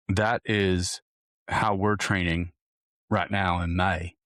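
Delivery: put-on slow, drawn-out Southern accent. Coach wrapping up his May training plan, in a slow Southern drawl. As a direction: drawl it out like a Southern good ol' boy. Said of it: "I don't know why I went to a Southern accent".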